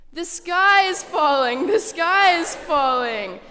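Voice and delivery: slow, loud, high panicked yell; panicked group shout. Acted. Reader leading the children in a loud slow panicked chant. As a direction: yell it out slowly with the whole room. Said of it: "Say it with me".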